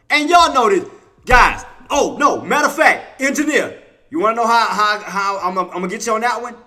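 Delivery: loud, high call to the chat over music; rally the viewers, loud and high; fired-up shout. Real, not acted. Calling out viewers on live stream.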